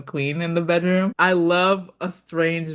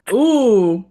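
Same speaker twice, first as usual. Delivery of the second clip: high, loud ooh of glee; a gleeful gotcha ooh; ooh, caught you; delighted ooh; 5 semitones higher, 8 dB louder than usual. Real, not acted. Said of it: "clock it".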